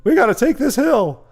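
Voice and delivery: high, loud cadence call; marching drill call. Acted. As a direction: shout the troops up the hill, high and loud. Acting out marching a group.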